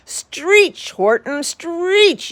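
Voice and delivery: high, loud yelled order; yelling. Acted. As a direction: bark the word stretch at him. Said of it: "yelled the bug".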